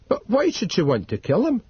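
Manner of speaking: put-on young Lockhart, puzzled. A narrator's reading. Narrator voices Lockhart.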